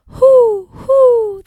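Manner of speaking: high, loud hooting owl; big owl hoo. Shown as a demonstration. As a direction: hoot like an owl. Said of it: "What sound does an owl make?".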